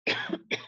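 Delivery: choking cough; cough, choking on a drink; coughing fit. Real, not acted.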